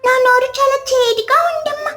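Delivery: put-on high small child's voice; a hungry little kid begging mom; whining little child. Hungry little child begging his mother, whiny.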